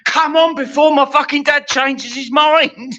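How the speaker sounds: high-pitched desperate plea; impatient urging. Retold or imitated. Kid allowed up late urging the telly on, high impatient outburst. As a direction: urge it up high, exasperated. Child urging TV programme to start.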